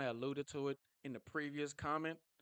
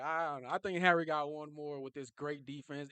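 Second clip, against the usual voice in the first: ordinary delivery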